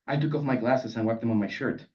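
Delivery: ordinary delivery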